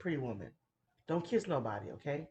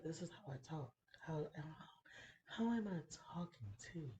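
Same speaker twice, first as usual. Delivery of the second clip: soft, much quieter late-night phone voice; sultry phone voice; 11 dB quieter than usual. Retold or imitated. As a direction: purr it into the receiver like it's natural. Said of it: "make your voice sound like this".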